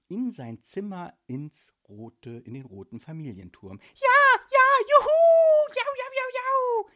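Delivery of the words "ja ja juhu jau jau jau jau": shrieking far higher and louder with whoops of joy; jubilant shout. Acted. Ghost who got his letter, whooping for joy. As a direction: cheer like you just won everything. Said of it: "Er schrie und jauchzte so laut".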